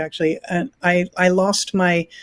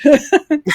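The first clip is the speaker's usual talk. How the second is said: short burst of laughter; 8 semitones higher, 5 dB louder than usual. Real, not acted. Laughs at starting virtual work early.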